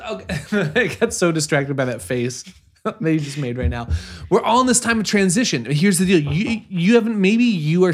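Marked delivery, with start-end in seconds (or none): 4.3-7.9 excited. Real, not acted. Pep talk building up.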